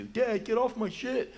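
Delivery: a hint of an irritated child; annoyed kid. Kid snapping at nosy parent.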